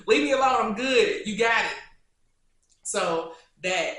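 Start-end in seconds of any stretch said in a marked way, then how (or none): 0.1-1.8 loud, higher mock plea; mock pleading. Conceding a rap battle to a rival, loud mock pleading. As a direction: back down loudly, hammed-up.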